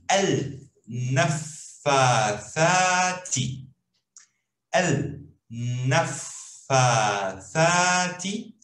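al-naffathati drawn out very slowly, syllable by syllable; slow Arabic word. Shown as a demonstration. Teacher sounding out al-naffathati slowly for students. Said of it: "com uma palavra grande".

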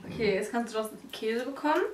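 ordinary talk

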